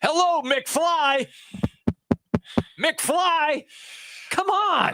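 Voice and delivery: slow, drawn-out taunt with gaps; mocking call. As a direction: call out as if nobody's home. Host mockingly imitates film bully's catchphrase.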